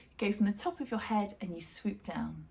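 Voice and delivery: ordinary delivery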